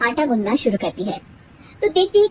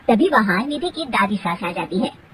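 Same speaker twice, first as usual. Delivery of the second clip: ordinary talk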